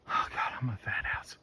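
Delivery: breathless whisper to himself; whispered, worn out, half to himself. Real, not acted. Exhausted after climbing the trail.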